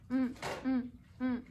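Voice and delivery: short closed-lip "Mm" hums; isolated letter sound. Shown as a demonstration. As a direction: hum "mm" briefly, evenly spaced. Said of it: "los sonidos".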